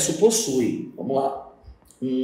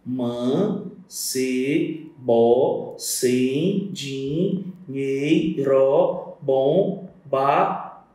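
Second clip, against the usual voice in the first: "Mancebo sem dinheiro, bom barrete" broken into slow, separate syllables with long pauses; 4 semitones lower, much slower than usual. Shown as a demonstration. Teacher marking off a verse's poetic syllables, syllable by syllable. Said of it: "colocar uma barra em cada sílaba poética".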